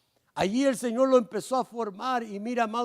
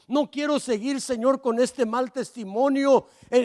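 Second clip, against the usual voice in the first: rising, louder pleading prayer to the Lord; fervent prayer; 3 semitones higher, 5 dB louder than usual. Acted. Preacher praying aloud to the Lord, louder and pleading. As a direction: beg God to change you, building in force.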